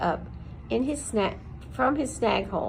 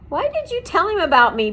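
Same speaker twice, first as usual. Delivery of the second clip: high, loud angry shout; 9 semitones higher, 9 dB louder, faster than usual. A narrator's reading. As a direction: shout it in alarm. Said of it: "Poppy shouted".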